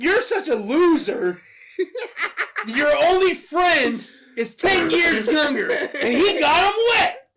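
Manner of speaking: high, loud, drawn-out ridicule; laughing, hammed-up angry scorn; mocking tirade. Dad mocking Billy's only friend, exasperated shouting. Mock rant continues.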